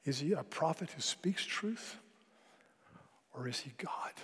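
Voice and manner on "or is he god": hushed whispered question; dramatic sermon whisper. Real, not acted. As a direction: lean in and whisper it. Preacher drops to a whisper.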